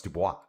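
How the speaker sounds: "Dubois" said doo-bwah, French style; "Dubois" with the bwah ending; Canadian French Dubois. Shown as a demonstration. Streamer backing the French "Dubois" to the bank guy. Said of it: "that's how we pronounce it here in Canada".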